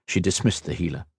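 ordinary delivery, nothing marked

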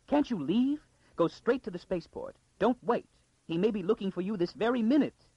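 very high, urgent plea; frantic urging. A narrator's reading. Lady urges the girl straight to the spaceport, frantic. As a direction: hurry her off with high, pressing cries.

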